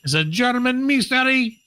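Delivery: high, hammed-up German accent; attempted German accent. Host joking about the ornament mystery, attempted German accent. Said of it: "how to do a German accent".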